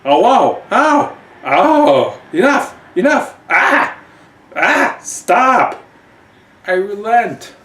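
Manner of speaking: high, loud yelping queen begging to stop; queen giving in. Acted. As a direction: squeal it out as she gives in. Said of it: "we hear the queen saying".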